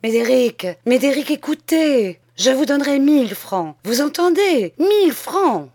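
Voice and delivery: high, loud, urgent begging; breathless pleading. A narrator's reading. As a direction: call after him, pleading desperately.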